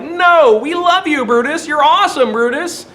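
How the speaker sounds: high, loud gushing fans cheering Brutus; mocking the fickle crowd, loud and adoring. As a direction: squeal it like a starstruck fan. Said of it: "And they're like".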